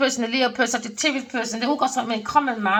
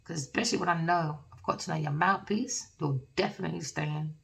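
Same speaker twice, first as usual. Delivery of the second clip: soft apology; 2 semitones lower, 8 dB quieter than usual. Acted. Lover voiced apologising softly.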